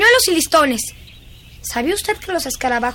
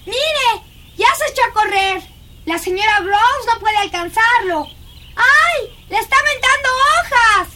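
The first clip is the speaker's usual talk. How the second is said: high, gleeful excited cries; gleeful exclaiming; 7 semitones higher than usual. Acted. Girl watching Miles throw leaves, gleeful. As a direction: cheer on the chase.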